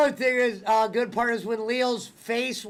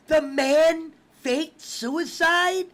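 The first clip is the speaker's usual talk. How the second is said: slow, higher, astonished question; incredulous movie voice; 5 semitones higher, much slower than usual. Retold or imitated. Host quoting Leo on TV, slow mock disbelief. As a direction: drag it out in disbelief. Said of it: "he goes".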